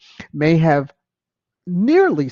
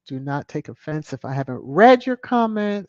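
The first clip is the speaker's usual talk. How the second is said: ordinary delivery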